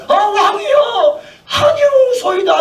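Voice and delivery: high, slow wailing protest with a laugh; 아니로소이다 cried out high and slow; pleading servants. Retold or imitated. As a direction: beg the king in a mock wail. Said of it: "(servants answer king)".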